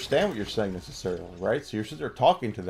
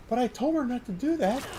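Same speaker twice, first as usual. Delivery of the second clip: whiny protest; 14 semitones higher than usual. Retold or imitated. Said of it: "We're like".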